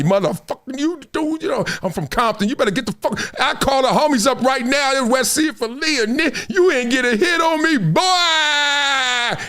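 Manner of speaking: high-pitched rant building to a long cried-out yell; mock blow-up, stretching the final word; hammed-up angry threat. Acting out telling off the trash talker, hammed-up fury.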